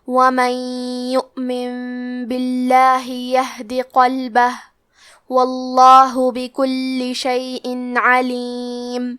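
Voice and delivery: slow, drawn-out tajweed recitation; Quran recitation. Shown as a demonstration. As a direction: recite slowly with measured elongation. Teacher recites Quran verses. (آہستہ تجوید کے ساتھ تلاوت)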